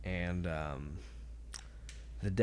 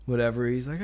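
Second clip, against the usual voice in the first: ordinary talk, nothing marked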